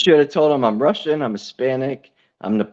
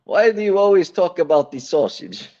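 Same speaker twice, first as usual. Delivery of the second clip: put-on Italian accent; 4 semitones higher than usual. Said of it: "fucking Italian".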